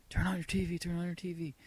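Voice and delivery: half-acted urgent friend on the phone; imitating urgent friend. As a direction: echo a friend's urgent call to wake up. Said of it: "and listen to the message".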